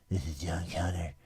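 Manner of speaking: low voice lisping through bottom teeth; lisping John Connor. Retold or imitated. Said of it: "I'm listening through my bottom teeth".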